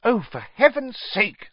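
high-pitched, exasperated anger; cross, impatient outburst; angry. A narrator's reading. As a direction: burst out in irritation. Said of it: "said Hermione angrily".

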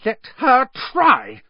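slow, loud, shrill defiance. A narrator's reading. Hermione dares Rita Skeeter, shrilly. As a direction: pitch it very high and defiant. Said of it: "said Hermione shrilly".

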